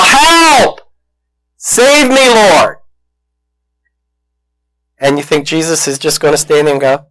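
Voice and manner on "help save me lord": high, loud cry for rescue, fading on Lord; desperate yell to God. Shown as a demonstration. Preacher showing the congregation how to cry out, loud yell. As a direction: shout it out like you're drowning. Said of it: "is to yell".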